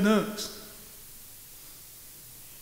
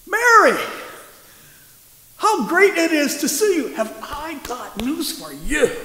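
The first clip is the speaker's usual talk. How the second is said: cheerful excited angel; 6 semitones higher than usual. Retold or imitated. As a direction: call her name like a delighted old friend. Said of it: "Says something more like this".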